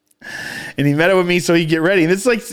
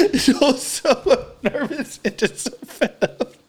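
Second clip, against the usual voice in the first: high voice squeezed by laughter; real giggling through the words; helpless laughing; 9 semitones higher than usual. Real, not acted. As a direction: struggle to narrate while cracking up. Cracking up telling story.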